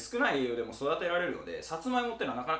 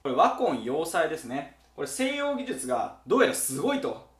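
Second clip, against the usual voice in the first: ordinary talk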